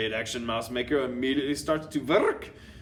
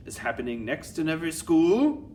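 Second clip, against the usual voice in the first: mildly raised villain voice, climbing high at the end; Grand High Witch; 6 semitones higher, 5 dB louder than usual. A narrator's reading. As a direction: toss the question up to the crowd. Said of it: "shouted the Grand High Witch".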